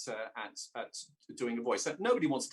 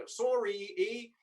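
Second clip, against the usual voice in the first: higher-pitched stock Canadian accent; fake Canadian accent; 7 semitones higher, much slower than usual. Shown as a demonstration. Briton showing sounds used in fake Canadian accents. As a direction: put on a British actor's fake Canadian. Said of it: "to our fake Canadian accents".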